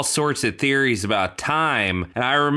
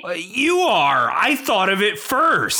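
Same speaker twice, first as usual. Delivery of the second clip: high-pitched defensive comeback; squabbling, pitch raised; indignant retort; 8 semitones higher than usual. Acted. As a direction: insist on being first. Quarrel over who was first.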